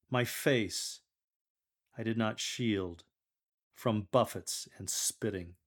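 slow, grave reading of suffering; dignified suffering, no self-pity; scripture reading. Acted. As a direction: lector reading the suffering servant steadily and slowly.

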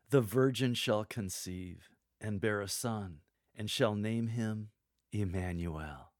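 slow, with pauses after conceive and son; slow and weighty. Shown as a demonstration. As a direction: let each phrase land with conviction. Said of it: "Say this slowly, with power and conviction".

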